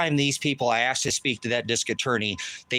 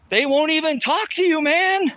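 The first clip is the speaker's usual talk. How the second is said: much higher, loud furious yell; angry outburst; 12 semitones higher, 3 dB louder than usual. Real, not acted. Father furious at the court yells out loud, much higher. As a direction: let the outrage burst out high.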